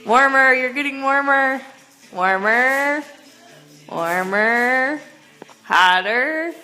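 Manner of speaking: loud game calls; calling out. Real, not acted. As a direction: call out the clues. Hot-cold game calling out.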